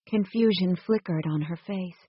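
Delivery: plain reading voice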